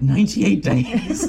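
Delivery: high, slow, disbelieving question with a laugh; can't believe the number; laughing disbelief at Ninety-eight; incredulous. Retold or imitated. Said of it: "And even then, I'm like".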